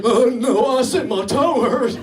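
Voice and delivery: loud, dopey self-parody; dim-witted patient. Retold or imitated. Patient lost in the doctor's jargon, dim-witted wail. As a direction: play yourself as a baffled idiot.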